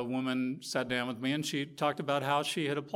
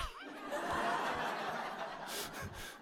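laughter; 5 dB quieter than usual. Real, not acted. Laughing at the story.

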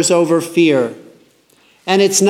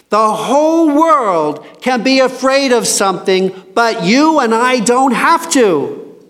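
fervent preaching; 7 semitones higher than usual. Real, not acted. Preaching climax to congregation.

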